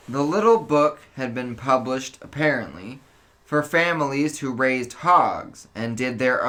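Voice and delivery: even, measured read-aloud of a novel; story narration. Acted. Host reading the mystery chapter to co-host, steady narration. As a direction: read the passage to the listeners like an audiobook.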